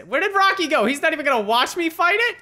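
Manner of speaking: high, loud outraged whine; indignant. Real, not acted. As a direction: complain loudly to nobody. Alone, outraged at missing teammate.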